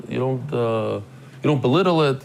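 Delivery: ordinary delivery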